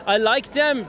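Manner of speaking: ordinary talk, nothing marked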